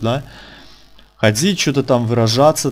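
ordinary talk, nothing marked

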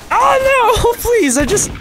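high, loud startled cry; hit hard crying out. Real, not acted. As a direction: yelp as you get hit. Hit in game, cries out.

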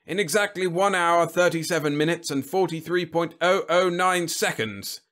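high, loud, boastful precision; proud. A narrator's reading. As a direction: announce it with swelling pride. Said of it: "he said proudly".

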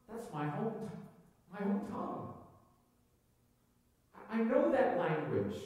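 amazed recognition. Acted. Foreigner hearing the apostles speak the home tongue, amazed. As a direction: act out a listener startled by familiar words. Listener recognises own language, acted.

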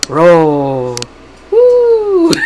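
whooping loudly through a pretend loop; playful flying noises. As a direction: call out stunts like a kid playing planes. Imitating a flying thing's noises.

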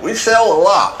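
loud imitated Texan car dealer. Presenter quoting the guy who owned it, mock Texan. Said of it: "And he went".

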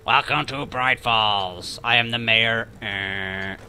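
slow, droning mayor impression; hammed-up slow mayor; mock mayor. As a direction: put on a slow zombie-like official voice, trailing to a low groan. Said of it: "that was the mayor, apparently".

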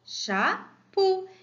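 "Chapeau" split into cha, then peau; syllable by syllable. Shown as a demonstration. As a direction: separate the two syllables of "Chapeau". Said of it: "La première syllabe". (« Chapeau » découpé en syllabes)

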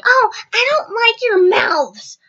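high, slow mocking imitation; lecturing bus driver. Bus driver lecturing the kids, mocked scolding. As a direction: drag out the scolding in a mock high voice. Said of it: "She lectures us for another ten minutes".

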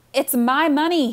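loud, high, slow shout. Acted. Brother shouting at his sister from under a pillow, loud and slow. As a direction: yell it, muffled through a pillow. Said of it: "he shouted".

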